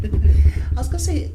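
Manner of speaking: giggling into the question; laughing. Real, not acted. Interviewer laughing while asking.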